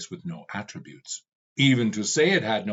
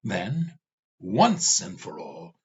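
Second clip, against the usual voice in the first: mad, insane; 3 semitones higher than usual. Acted. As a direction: vow it like a madman. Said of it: "Ravana sounded mad, insane".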